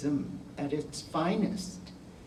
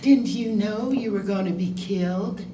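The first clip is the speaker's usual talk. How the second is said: raised, louder imitated Southern accent; 5 semitones higher, 6 dB louder than usual. Vice principal breaking news to a former student, mock Southern accent. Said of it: "Southern accent".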